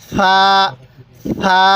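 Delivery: সা with tongue touching the teeth tips; soft, lisped th in সা, held long; tongue-tip soft pronunciation. Shown as a demonstration. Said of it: "দাঁতের আগার সাথে লাগিয়ে দিবেন".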